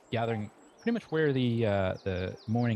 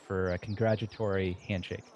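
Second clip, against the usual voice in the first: ordinary talk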